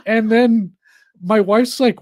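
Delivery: normal talking voice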